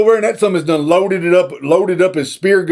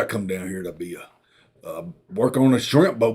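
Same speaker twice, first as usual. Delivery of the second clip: low mumbling Forrest; 14 semitones lower, 9 dB quieter than usual. Retold or imitated. Forrest voiced low and quiet.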